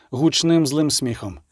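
usual speaking voice

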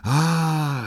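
a high, awed ah; delighted gasp. Acted. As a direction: marvel at the snow globe. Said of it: "zawołał Ryjek z zachwytem".

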